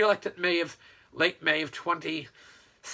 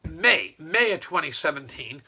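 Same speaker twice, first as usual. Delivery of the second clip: normal talking voice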